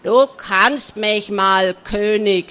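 slow, loud pirate scolding; angry scolding. A narrator's reading. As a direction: tell the king off, loudly and slowly. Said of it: "schimpfte Barty Blue". (wütend schimpfend, laut und langsam)

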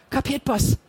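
put-on intimidated young man, scared; anxious. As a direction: give in nervously. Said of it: "wiederholte der junge Mann ängstlich".

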